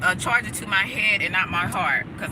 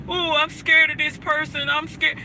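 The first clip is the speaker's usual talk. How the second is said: higher-pitched mock whimper of fear; mock scared crying; 7 semitones higher than usual. Live streamer mocking weak, fearful criers, in a put-on scared whine. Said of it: "I ain't talking about crying like".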